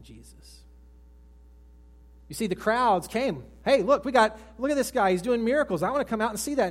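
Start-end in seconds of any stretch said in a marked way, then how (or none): 3.6-6.7 loud, higher eager crowd calling out; excited crowd. Acted. Preacher voicing the eager crowds, loud and excited. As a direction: call out like a thrilled onlooker.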